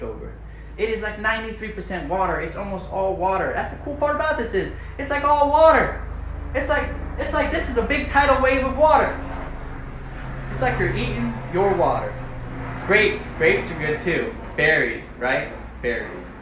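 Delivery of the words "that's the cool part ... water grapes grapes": high, loud, thrilled; excited gushing. Real, not acted. Gushing to viewers about water-filled fruit, high and excited. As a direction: gush like it's the best news ever.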